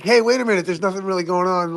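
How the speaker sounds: lightly voiced calm doubter, high; a mock sensible voice pointing out nothing's wrong; skeptic. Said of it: "somebody's like".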